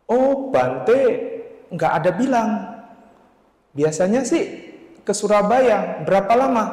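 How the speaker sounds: high, lightly acted young man's reply; voicing the foster son. Foster son telling the narrator the monk's whereabouts, in a high put-on voice.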